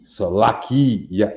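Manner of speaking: Salaki ya said slowly with a clear ya ending; teacher giving the right form; correct pronunciation. Shown as a demonstration. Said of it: "That's not pronounced E".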